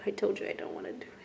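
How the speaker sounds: hushed, breath giving out; fighting back real tears; real near-tears hush. Real, not acted. Tearful retelling, fighting tears.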